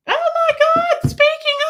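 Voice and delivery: very high, loud gushing exclamation; excited squeal. Real, not acted. Host reacting to the next picture, excited squeal.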